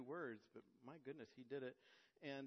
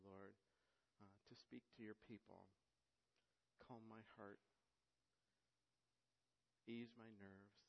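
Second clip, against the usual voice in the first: prayer; 4 semitones lower than usual. Real, not acted. Opening prayer before sermon.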